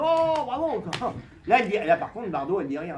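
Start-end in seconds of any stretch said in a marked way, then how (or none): 0.0-0.8 louder, higher fake bravo; mock crowd cheer.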